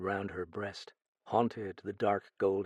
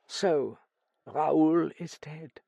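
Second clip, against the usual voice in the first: high, slow, full of self-pity; small broken voice; 7 semitones higher, 4 dB louder, much slower than usual. A narrator's reading. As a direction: let it out haltingly, pitying yourself. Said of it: "She talked in a small, broken voice".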